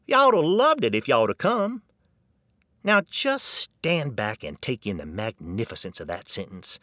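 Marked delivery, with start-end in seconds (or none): 0.0-1.8 high, loud Texan accent demo; Texan accent, proud; Texan example. Shown as a demonstration. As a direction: show off the contraction like a proud Texan. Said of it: "here's how you use it".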